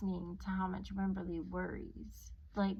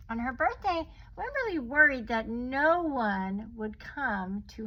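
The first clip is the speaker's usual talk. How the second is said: put-on reading to children; 2 semitones higher, 7 dB louder than usual. A narrator's reading. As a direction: read it out for the little ones. Story narration to children.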